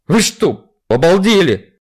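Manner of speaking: loud outraged shout; indignant yell. Acted. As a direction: bark it at the patient. Doctor yells after hair pulled.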